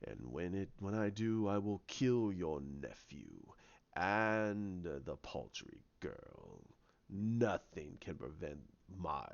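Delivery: deep, slow, half-whispered villain rasp; Snoke's voice. Retold or imitated. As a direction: loom over him, low and menacing, breathing the words. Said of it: "He goes".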